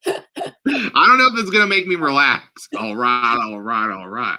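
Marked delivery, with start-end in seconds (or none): 2.8-4.4 lazy laid-back Southern drawl; Matthew McConaughey. Retold or imitated. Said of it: "Matthew McConaughey one".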